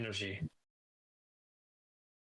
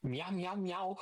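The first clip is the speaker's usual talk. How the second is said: cat voice; 9 semitones higher, slower than usual. Pretend. Mic test meowing.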